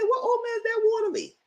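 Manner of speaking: squeaky high outraged question; disgusted disbelief. Retold or imitated. Reacting to Allison's watery oatmeal, high outraged squeak. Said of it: "I said".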